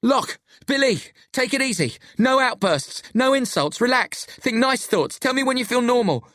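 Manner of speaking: high-pitched, insistent instructions; urgent commands. A narrator's reading. Friend orders the squabbling pair to calm down, urgent.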